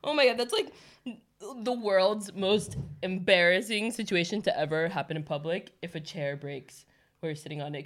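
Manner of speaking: ordinary talk, nothing marked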